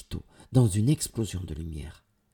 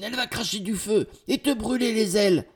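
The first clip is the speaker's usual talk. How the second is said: loud, high, panicked cry; high-pitched alarmed yelling; yelled warning; 13 semitones higher, 5 dB louder than usual. A narrator's reading. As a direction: shout the danger to a companion. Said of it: "hurle Valentine".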